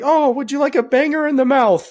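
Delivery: a touch of high prim British lady; lightly voiced Mrs Doubtfire, British accent. Tobias doing Mrs Doubtfire to Michael, British accent. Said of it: "Missus Doubtfire stick".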